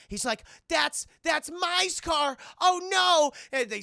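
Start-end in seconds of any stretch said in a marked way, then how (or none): 0.7-3.3 high, loud, drawn-out panic; hammed-up horrified wail; panic attack. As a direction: cry out in mock hysteria. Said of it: "starts having a panic attack".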